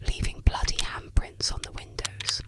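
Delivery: usual speaking voice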